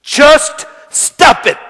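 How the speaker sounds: loud, drawn-out, word by word; forceful command. Shown as a demonstration. Preacher hammering home the only words to say, forceful. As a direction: punch each word hard and slowly.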